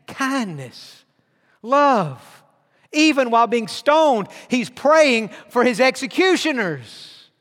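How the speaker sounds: ordinary talk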